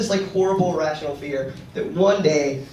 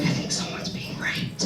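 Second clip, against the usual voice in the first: whispered alarm; 3 semitones lower, 8 dB quieter than usual. Retold or imitated. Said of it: "going up to the register and being like".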